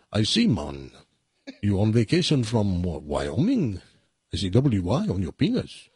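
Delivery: put-on a lightly done Jamaican accent, low and unhurried; joke-telling Jamaican accent. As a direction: tell it like the Jamaican attendant in a joke. Joke voices the Jamaican attendant.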